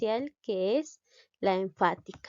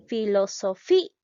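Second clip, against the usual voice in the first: "Filosofía" with FÍ punched loud, A set apart; stress on FI; 5 dB louder than usual. Shown as a demonstration. Teacher showing the closed vowel sounds stronger, stressing FÍ in "Filosofía". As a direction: drive the force onto FÍ in "Filosofía". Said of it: "la vocal cerrada suena más".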